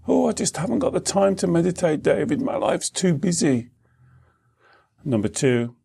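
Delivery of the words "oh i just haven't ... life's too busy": higher, faster mock complaint; hammed-up, rushed excuse in a raised pitch; busy excuse-maker. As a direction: imitate a harried student making excuses. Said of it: "How many of you have said that".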